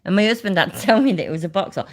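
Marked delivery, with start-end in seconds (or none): none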